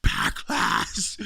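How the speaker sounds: mock out of breath, low and breathy; pant, then push the word out low; Triple H. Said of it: "Why is you out of breath, sir?".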